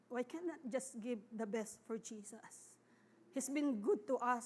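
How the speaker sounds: usual speaking voice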